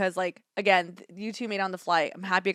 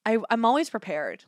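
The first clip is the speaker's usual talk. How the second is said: ordinary talk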